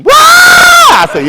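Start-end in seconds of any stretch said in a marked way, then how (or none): 0.0-0.1 long, very high, loud wail; imitated scream. Man imitates a woman screaming at his coat, full-out scream. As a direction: shriek out a drawn-out "wah". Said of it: "I heard her just scream".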